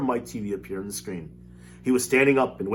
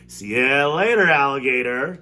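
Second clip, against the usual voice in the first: louder, slow taunting call; shouting; 4 semitones higher, 8 dB louder, slower than usual. Acted. Boy shouts a cheeky goodbye, louder and slow. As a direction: sing it out cheekily. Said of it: "he shouted".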